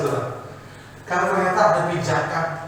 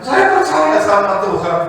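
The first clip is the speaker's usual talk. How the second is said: loud insistence; 4 semitones higher, 8 dB louder than usual. Acted. Climber insists.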